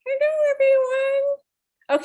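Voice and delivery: high-pitched, drawn-out puppet voice. Pretend. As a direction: greet everyone as a little ox, very high. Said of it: "Can you say hello to everyone?".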